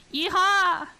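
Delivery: high, loud Southern cowboy whoop; southern yeehaw. Shown as a demonstration. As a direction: holler it like a Southern cowboy on request. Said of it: "Say yeehaw".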